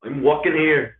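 loud, high Irish-sounding attempt; Irish-sounding accent. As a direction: holler it in an off-target Irish-like accent. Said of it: "That sounded more Irish".

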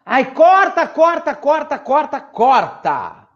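high, loud cut-it-out; exasperated shout. Acted. [name] cutting off the gloom. (gritando irritada)

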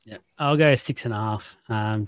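ordinary delivery

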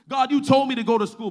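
loud, high-pitched protest; frustrated complaint. Acted. Preacher voicing believer complaining to God, loud frustrated protest. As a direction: imitate an exasperated believer challenging God. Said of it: "we're frustrated".

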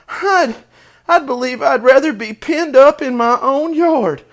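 high, loud little-pig voice; distressed young pig. A narrator's reading. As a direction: voice a cornered piglet, high and upset. Frightened cornered pig thinking, acted.

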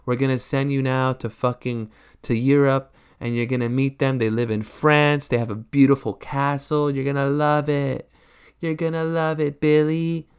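lightly voiced sugary orphanage worker. Orphanage worker luring Billy, mildly sugary voice. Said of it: "They're like".

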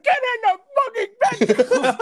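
high, loud barked command; furious chef order. Acted. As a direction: roar it at her in a high voice. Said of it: "And he's like".

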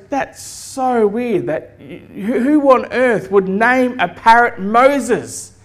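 slow, acted bafflement; baffled burglar. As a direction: lightly play a burglar who can't believe it. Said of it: "And the guy goes".

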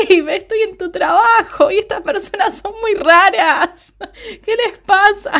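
high, laughing mock shout into the phone; Villanelle shouting to Eve on the phone, amused. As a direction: call out gleefully as Villanelle. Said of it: "Villanelle grita".

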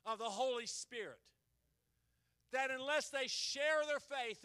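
slow, loud praying; fervent prayer. Acted. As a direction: pray it out with fire.

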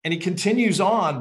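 ordinary delivery

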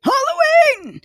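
high excited squeal; excited cheer. Real, not acted. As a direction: squeal it with delight. Cheering shared love.

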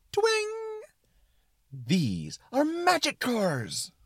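very high, excited twang then slow proclamation; excited suspenders. Acted. Suspenders of disbelief explaining magic cars, excited and high. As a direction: proclaim it with high, stretched-out glee. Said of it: "talk in an excited tone".

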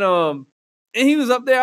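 normal talking voice

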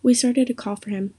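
plain reading voice

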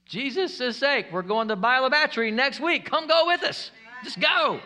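high, loud, insistent plea; exasperated urging. Real, not acted. Preacher pushing congregation to join next week's outreach, exasperated urging.

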